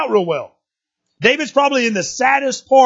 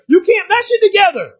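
high, pressed insistence; emphatic denial; 12 semitones higher, 5 dB louder than usual. Real, not acted. Preacher correcting the youth, insisting emphatically. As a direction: drive home that it cannot mesh.

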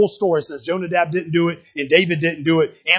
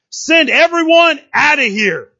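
acted command; 7 semitones higher, 4 dB louder, slower than usual. Acts out the man's command.

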